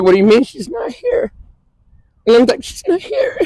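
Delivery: real tearful strain, pitch breaking upward. Real, not acted. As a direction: voice climbing high as tears take over. Breaking down retelling sister's death.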